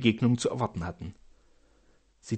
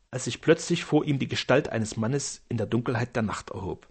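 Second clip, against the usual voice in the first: plain reading voice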